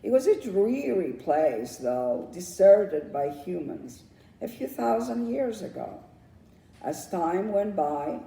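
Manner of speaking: usual speaking voice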